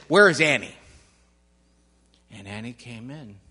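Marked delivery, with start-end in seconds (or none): 0.1-0.7 loud, urgent demand. A narrator's reading. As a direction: demand it sharply. Police demand the girl.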